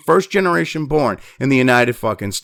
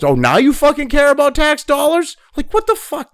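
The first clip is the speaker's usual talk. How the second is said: incredulous scorn; 10 semitones higher than usual. Real, not acted. Ranting at leftists.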